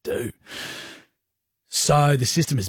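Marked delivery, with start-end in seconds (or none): 0.0-0.3 hushed pirate-style panic; softer, mock-bewildered parents. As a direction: mutter it like a lost sailor. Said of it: "we are".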